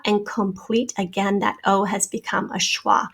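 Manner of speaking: normal talking voice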